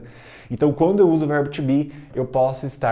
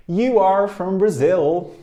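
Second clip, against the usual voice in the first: slow, raised and louder model line; 5 semitones higher, 5 dB louder, much slower than usual. Shown as a demonstration. Teacher models the line slowly and loudly. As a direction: announce it slowly to the class. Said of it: "Repeat after me".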